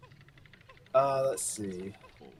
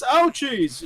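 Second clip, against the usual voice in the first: higher, dopey cartoon whine; 9 semitones higher, 4 dB louder than usual. Shown as a demonstration. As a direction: play it dim and goofy. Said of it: "sort of a dopey voice like this".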